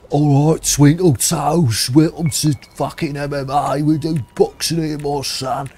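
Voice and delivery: put-on Cockney accent, drawn out like a London boxing trainer. Gamer mimicking the game's London trainer in a Cockney accent. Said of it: "That was, like, super London accent".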